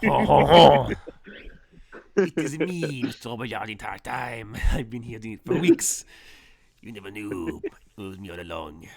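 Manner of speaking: hammed-up French villain, booming at first, then settling; mock French accent. Game master jokingly revealing the Frenchman, mock French accent. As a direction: gloat in a jokey French accent. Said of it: "make her have a French accent".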